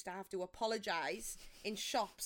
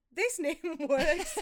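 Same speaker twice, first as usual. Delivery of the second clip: laughing; 6 semitones higher, 9 dB louder, much slower than usual. Real, not acted. Laughing while speaking.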